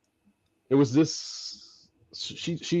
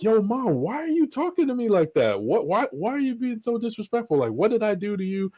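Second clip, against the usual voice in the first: going off; 10 semitones higher, faster than usual. Retold or imitated. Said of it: "went off".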